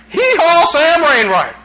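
playful hee-haw greeting; Sam Wainwright. Acted. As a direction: holler it like a jokey catchphrase. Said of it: "The guy went".

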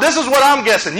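ordinary talk, nothing marked

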